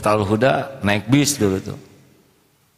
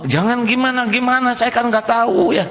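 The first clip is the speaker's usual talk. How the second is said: exasperated; 14 semitones higher than usual. Real, not acted. Preacher's exasperated reply.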